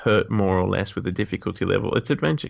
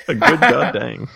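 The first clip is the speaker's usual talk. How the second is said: laughing; 7 semitones higher, 4 dB louder than usual. Real, not acted. Laughing through a joke.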